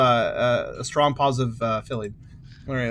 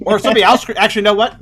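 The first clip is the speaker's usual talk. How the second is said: cheeks stuffed, still chewing; mouth full; 5 semitones higher, 8 dB louder, much faster than usual. Real, not acted. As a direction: talk around the food in your mouth. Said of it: "I will talk with my mouth full".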